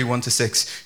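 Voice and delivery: usual speaking voice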